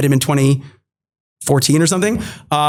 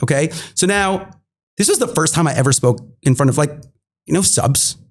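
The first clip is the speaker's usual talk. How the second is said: ordinary talk, nothing marked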